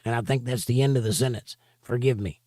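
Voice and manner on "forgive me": ordinary talk, nothing marked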